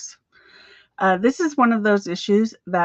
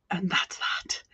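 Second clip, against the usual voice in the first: a breathy stage whisper to close the point; playful conspiratorial whisper; whispered aside; 2 semitones lower, 7 dB quieter, slower than usual. Acted. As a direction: drop to a secret whisper.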